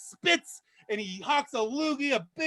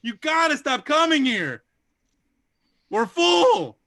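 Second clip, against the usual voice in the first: loud, higher, exasperated snapping; angry maitre d'; 3 semitones higher, 7 dB louder, slower than usual. Acted. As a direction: shoo the guests out, fed up and loud. Maitre d' voice in joke.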